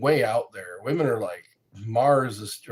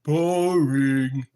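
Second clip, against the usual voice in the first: mock bored; 4 dB louder than usual. Shown as a demonstration. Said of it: "gets boring".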